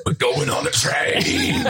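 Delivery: high, slow rock-singer yell. Retold or imitated. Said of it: "Oh, the rock voice".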